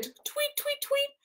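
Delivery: high, soft chirping tweets; tiny chirpy birdcall; bird tweets. Acted. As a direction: pipe it like a little bird. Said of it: "It tweeted".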